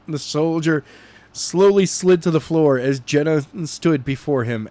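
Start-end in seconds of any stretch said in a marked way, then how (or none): none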